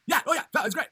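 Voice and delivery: lightly voiced dopey Fallon; dopey Jimmy Fallon. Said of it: "He's like".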